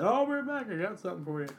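high, mock bright host voice; mimicking cohost. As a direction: parody his cheery opener. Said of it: "when you said".

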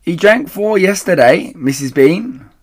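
loud, high reply; shouting upstairs. Acted. The woman calls up to Missus Bean, loud and high. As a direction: shout it up the stairwell.

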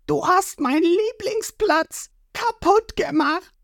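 high, slow cartoon accusation; indignant boy hero. Ruffy blaming the whale for the figurehead, indignant. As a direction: scold the whale like a wronged kid.

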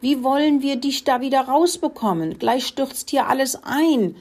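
high-pitched fretful complaint; wailing. A narrator's reading. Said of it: "jammerte Muckelinchen". (hohes, besorgtes Jammern)

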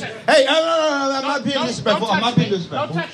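high-pitched string of no's; real high-pitched objection; hasty protest. Real, not acted. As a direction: back off in a high, placating protest. Hastily protesting to an audience member.

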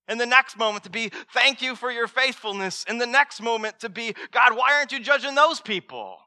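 high, loud, impassioned sermon delivery; full-force preaching. Acted. Pastor preaching hard to his congregation, loud and impassioned. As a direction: preach it big to stir the congregation.

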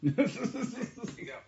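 loud, high laughing reply. Real, not acted. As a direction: laugh the words out. Laughing at saloon drinking joke.

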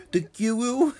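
high squeaky answer; squeaky kid voice. Retold or imitated. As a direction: squeak out the gun name. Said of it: "he's like".

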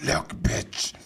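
creepy villain hissing; horror villain whisper. Pretend.

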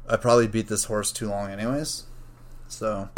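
ordinary delivery

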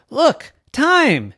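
high, loud call; coach shouting. Acted. Coach calls to a player not paying attention, shouting.

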